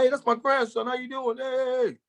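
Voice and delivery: high-pitched imitation of a Jamaican grandmother; Jamaican lady. Jamaican lady at her window greeting her grandson, high imitated voice. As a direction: call out high as a Jamaican granny, drawing out the last word. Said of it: "she'll be Jamaican and be like".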